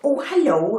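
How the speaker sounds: high-pitched mock old-lady greeting; wee old lady. Old tourist greeting a bookshop worker, high imitation. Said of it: "wee old ladies came over to me".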